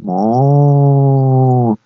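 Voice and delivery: long drawn-out imitated moo; man faking a cow's moo; fake cow moo. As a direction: pretend to be a cow mooing. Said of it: "先生还在装牛的叫声".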